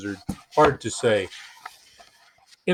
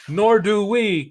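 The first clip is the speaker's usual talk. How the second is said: knights' chorus; 6 semitones higher, 3 dB louder than usual. Acted. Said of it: "chorused the knights".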